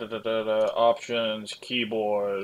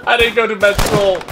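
raised pitch, louder quip; loud joking retort; 8 semitones higher, 9 dB louder than usual. Real, not acted. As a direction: shout back a joke. Teammates yelling for a medic.

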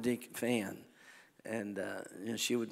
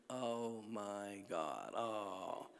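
low, soft swooning fan; imitated awestruck mother; 3 semitones lower, 8 dB quieter, much slower than usual. Mother seeing her favourite singer up close, imitated low swoon. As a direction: mimic Mom sighing over her idol. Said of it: "my mother's over here going".

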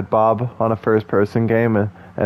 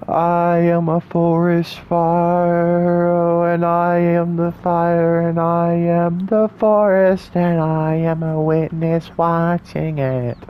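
slow, drawn-out poetic delivery; slow mock-profound poem voice; dramatic recitation; 6 semitones higher, slower than usual. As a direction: intone it like a grand monologue.

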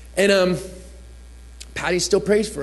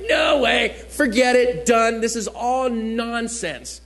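raised voice, exasperated disbelief; indignant refusal; 5 semitones higher, slower than usual. Retold or imitated. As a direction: shut it down in mock outrage. Retelling his outraged refusal of bikers.

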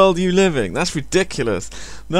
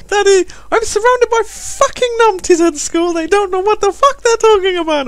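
very high falsetto daughter; mock child complaining to daddy; little girl voice. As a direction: squeal it like an exasperated little girl. Voices his imagined swearing little daughter.

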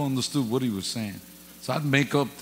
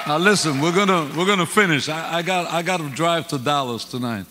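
preaching; 5 semitones higher, 9 dB louder than usual. Acted. Preaching at full force.

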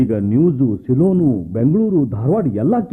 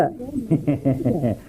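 soft laugh; 6 dB quieter than usual. Acted. Repairman laughs sheepishly.

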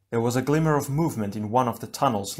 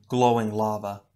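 usual speaking voice

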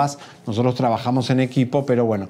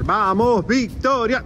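higher, rousing chant to a crowd; rally the fans into shouting; cheerleading call; 7 semitones higher, much slower than usual. Pretend. Reporter urging fans to cheer Victoria, cheerleading call.

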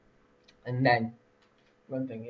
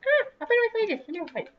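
much higher, playful put-on voice for Lily; voicing the dog; faster than usual. Player voicing his dog wanting to fight, much higher put-on voice. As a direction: squeak it out like an eager little dog. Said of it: "It's like".

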